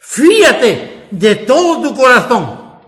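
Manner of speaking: loud, driving call to trust with all your heart; fervent preaching. Real, not acted. Preacher urging the congregation to trust Jesus, loud and fervent. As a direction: build each "con toda tu" into a rising exhortation.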